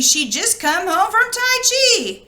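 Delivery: ordinary delivery, nothing marked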